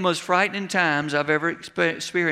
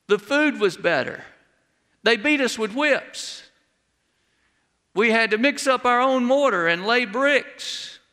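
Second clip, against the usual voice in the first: whining complaint; 5 semitones higher than usual. Acted. Preacher voices Israelites complaining.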